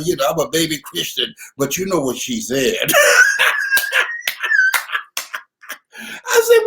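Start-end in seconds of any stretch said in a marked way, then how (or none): 2.8-5.4 a very high laughing squeal that climbs; rising shriek of laughter. Real, not acted. Overcome by his own story, he squeals with laughter. Cracks up at the punchline.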